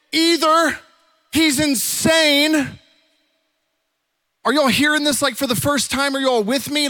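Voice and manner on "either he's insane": emphatic exclamation. Real, not acted. Preacher exclaims about Jesus' claims.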